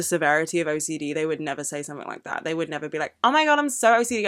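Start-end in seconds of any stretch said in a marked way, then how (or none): 3.2-4.3 higher-pitched mock gushing; lightly mimicked airhead remark; mocking imitation. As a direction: parrot a clueless person, pitched up. Mocking people who claim OCD.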